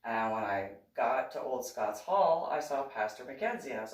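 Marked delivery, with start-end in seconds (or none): none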